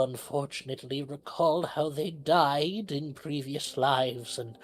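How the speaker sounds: lightly voiced pie seller. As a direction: sell the magic gently. Seller explains her pastries.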